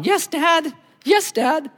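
put-on high, meek yes dad, like a boy; meek son. A grown son answering his father meekly. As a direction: play the grown son still obeying.

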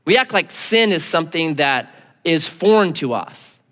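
ordinary talk, nothing marked

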